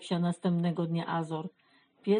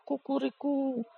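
rooster crowing; 7 semitones higher than usual. A narrator's reading. Rooster argues with the beavers, crowing. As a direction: crow it out like a storybook rooster. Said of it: "zapiał kogut".

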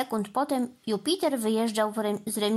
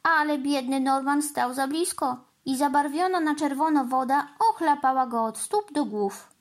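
usual speaking voice

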